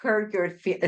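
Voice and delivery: ordinary delivery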